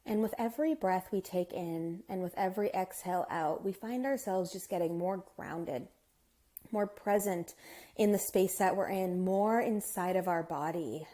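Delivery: soft, hushed meditation guide with unhurried pauses; guided meditation. Acted.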